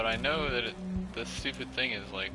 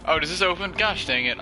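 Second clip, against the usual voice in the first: loud frustrated outburst; 7 semitones higher, 10 dB louder than usual. Real, not acted. Door opens after struggle.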